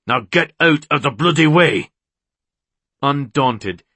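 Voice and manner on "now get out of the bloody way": louder, forceful command; angry order. A narrator's reading. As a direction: order her aside, raising your voice. Orders her out of his way.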